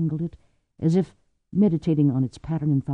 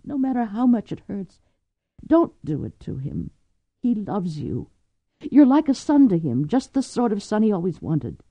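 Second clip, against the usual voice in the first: higher, earnest plea; Cordelia pleading; 7 semitones higher than usual. A narrator's reading.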